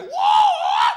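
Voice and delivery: high, loud shocked shout; a big sudden "Whoa!"; wife's shout. Retold or imitated. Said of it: "she's like".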